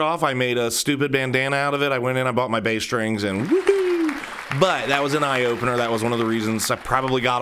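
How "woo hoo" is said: high mock whoop of triumph; mock cheer. Shopper celebrating his bought bass strings, high mock whoop. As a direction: cheer like you won a prize.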